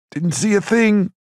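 high-pitched, slow Irish brogue. A narrator's reading. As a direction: call back in an Irish lilt. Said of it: "someone with an Irish brogue said".

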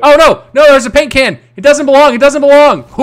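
loud, high excited shouts; shouting. Real, not acted. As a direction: yell it out in alarm. Excited shouting at game object.

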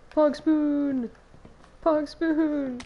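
hyped shout of the item name; excited. Real, not acted. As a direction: cheer it out loud. Excited game item exclamation.

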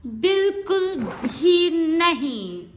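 sing-song mock refusal; emphatic no. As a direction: stretch it out as a playful refusal.

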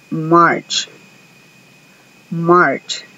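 March said short, one syllable; model pronunciation. Shown as a demonstration. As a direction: clear English model. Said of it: "escuchen cómo se pronuncia".

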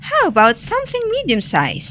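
quick, high-pitched suggestion; hurried. A narrator's reading. Wolf steers Rabbit off elephants, said quickly. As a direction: rush it out high. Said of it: "said the wolf quickly".

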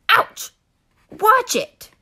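high sharp ouch; pained yelp. Acted. Arkansas jabbed at the bottom, high pained yelp. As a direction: yelp in pain then scold.

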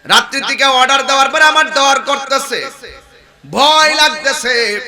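loud declaimed confession of fear; calling out. Acted. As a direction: preach the quote at full force. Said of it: "ডাক দিয়া বলে". (জোর গলায় ডাক দিয়ে বলা)

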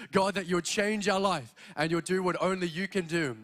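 usual speaking voice

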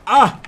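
short, loud, high cry; a sudden "ah!"; startled yell. Real, not acted. Player startled mid-race, startled yell.

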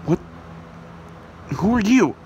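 loud, high-pitched challenge; startled, demanding yell; alarmed shout. Acted. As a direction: shout it at the intruder. Hero confronts intruder taking listener.

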